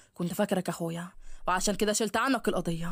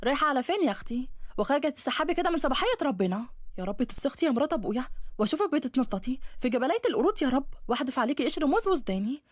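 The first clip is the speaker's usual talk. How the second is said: higher mocking stepmother voice; a sharp, needling stepmother taunting her stepdaughter; nagging stepmother; 6 semitones higher than usual. Stepmother Ne'ma mocking Laila as she leaves, higher nagging voice.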